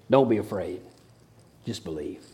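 soft, tender reassurance fading to a hush; calm a frightened father; quiet comforting words; gentle reassurance. Acted. Jesus comforting the desperate father.

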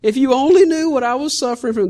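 high, wailing "oh God" lament; a hammed-up prisoner's whine, pitched high; self-pitying complaint. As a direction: moan to God like you've been abandoned.

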